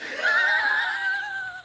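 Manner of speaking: very high giggly squeal; high squealing laugh. Real, not acted. Delighted laugh after the quote.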